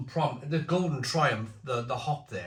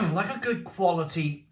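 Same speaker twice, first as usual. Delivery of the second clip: chesty cough; 4 semitones higher than usual. Real, not acted. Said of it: "Update on the cough".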